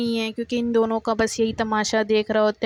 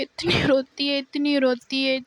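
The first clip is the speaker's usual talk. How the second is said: ordinary delivery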